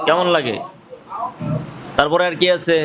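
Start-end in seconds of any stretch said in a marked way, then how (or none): none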